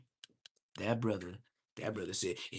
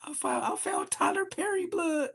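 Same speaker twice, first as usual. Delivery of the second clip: high laughing taunt, much higher voice; laughing mockery. Real, not acted. Mocking his rival with high laughter. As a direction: crack up while naming him.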